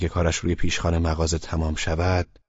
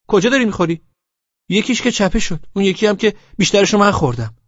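put-on high, loud girlfriend voice; pitched up as the annoyed girl; girl's voice; 12 semitones higher, 8 dB louder than usual. A narrator's reading. Laleh scolding him for being late, high voice.

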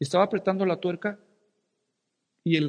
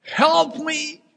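higher, louder cry for help in English; shouting in English; 11 semitones higher, 6 dB louder than usual. Retold or imitated. Pinned arm, calling neighbors in English, loud cry. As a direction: call out in your best English. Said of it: "salió mi mejor inglés".

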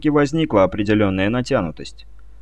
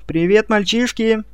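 put-on high chirpy airhead girl voice; cartoon princess; 9 semitones higher than usual. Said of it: "радостно прощебетала".

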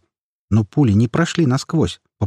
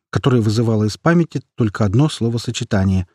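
ordinary delivery

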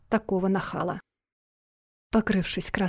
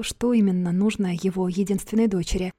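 ordinary delivery, nothing marked

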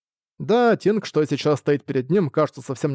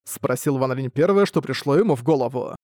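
ordinary delivery

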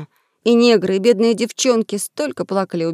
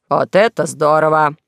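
sarcastic exclaim; 5 semitones lower, 5 dB louder, slower than usual. Acted. King's sarcastic outburst.

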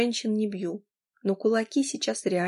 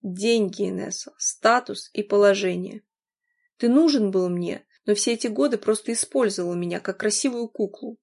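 plain reading voice

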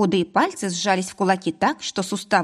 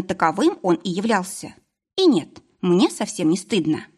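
plain reading voice

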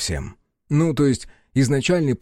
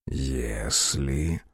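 low seductive whisper; 11 semitones lower, 6 dB quieter than usual. Acted. Snake speaks low, seductive.

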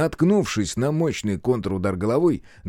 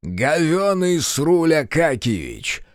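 slow, raised announcing voice; brazen proclamation; 6 semitones higher, 3 dB louder, slower than usual. A narrator's reading. Captive presenting his servant, brazenly proclaiming. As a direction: proclaim it slowly with a cheeky grin. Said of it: "провозгласил с нахальной улыбкой пленник".

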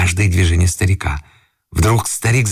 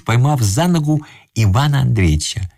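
ordinary delivery

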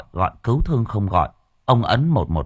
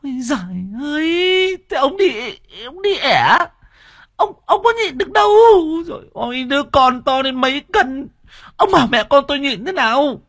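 very high, strained, pained scolding; wife in labor pain; 4 dB louder than usual. Acted. Wife in labor snapping back at her husband, high pained. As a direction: squeal it out through the contractions. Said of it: "đau gồng đến nổi cả gân trán".

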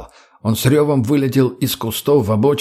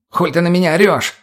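high, indignant comeback; defensive retort; 7 semitones higher than usual. A narrator's reading. Mutny answers Lena's scolding, snapping back. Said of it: "огрызнулся Мутный".

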